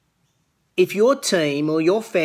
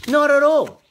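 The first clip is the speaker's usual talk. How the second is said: emphatic denial; 10 semitones higher, 5 dB louder than usual. Real, not acted. Insisting he never raised voice.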